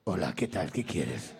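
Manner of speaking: [name]'s disguised voice. Retold or imitated. [name] on the phone, faking a voice to dodge callers, very low. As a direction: drop the voice deep to pass as someone else. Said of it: "cambia la voz".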